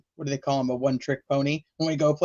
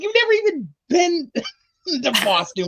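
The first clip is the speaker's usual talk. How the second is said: mock exasperation; 11 semitones higher than usual. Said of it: "I'm like".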